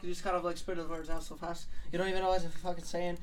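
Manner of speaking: ordinary talk, nothing marked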